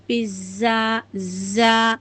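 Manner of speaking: پیزا then زا with a buzzing z; buzzing z demo. Shown as a demonstration. Teacher shows the buzzing z sound through the word pizza. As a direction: buzz the z in زا like in پیزا. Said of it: "جیسے دیکھیے ہم لوگ پیزا بولتے ہیں".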